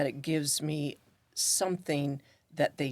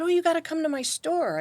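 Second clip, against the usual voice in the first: excited invite; 12 semitones higher, 5 dB louder than usual. Retold or imitated.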